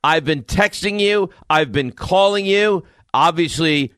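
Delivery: high-pitched, drawn-out complaint; retold aggrieved yelling. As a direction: imitate an upset wife calling down accusations. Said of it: "I get a yell down".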